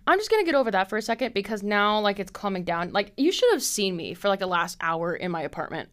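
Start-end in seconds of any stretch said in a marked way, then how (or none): none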